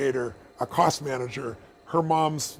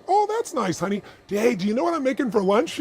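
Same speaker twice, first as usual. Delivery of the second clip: very high, singsong wifely voice; breezy wife; 7 semitones higher than usual. Acted. Wife brushes off husband's big bonus news, breezy and high-pitched. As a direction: chirp it, blithely changing the subject. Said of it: "And she goes".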